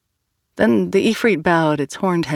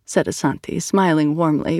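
plain reading voice

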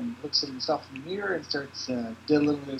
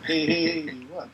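ordinary talk, nothing marked

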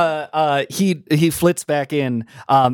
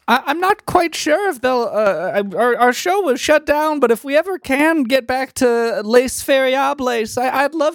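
pitched way up, wistful little fairy; high fairy voice; 10 semitones higher than usual. Acted. GM redoing the fairy's line in the right high voice. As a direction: redo it high and sighing.

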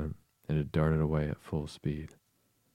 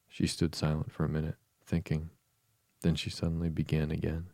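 plain reading voice

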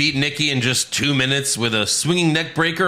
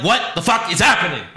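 raised, incredulous outburst; appalled comic disbelief; exasperated disbelief; 5 semitones higher than usual. Real, not acted. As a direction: react baffled, pushing the pitch up. Exasperated reaction to bad segment.

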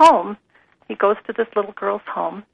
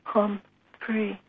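softly sounding out an unfamiliar name; hesitant, softer, syllable by syllable; tentative attempt; 7 dB quieter than usual. A narrator's reading. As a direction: read as a careful learner trying it. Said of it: "looked a little frightened, but she tried".

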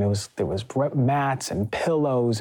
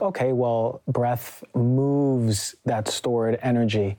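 drawn out, very slow thought; slowly savouring the idea; slow mulled-over quote; much slower than usual. Retold or imitated. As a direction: stretch it out like thinking aloud. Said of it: "oftentimes I'm like".

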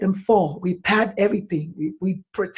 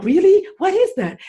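very high, gushing interest; mother playing along with her four-year-old; delighted mom; 13 semitones higher, 5 dB louder than usual. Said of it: "You're like".

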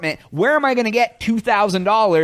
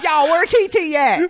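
exaggerated street accent; 10 semitones higher than usual. Teasing his cohost, hamming up a street-accent shout. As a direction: ham up the street accent, laughing through it. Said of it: "That's even worse".

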